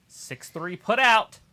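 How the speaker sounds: loud, slow, high call-out; excited call. Real, not acted. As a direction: call it out to the room. Calling a wrestling count.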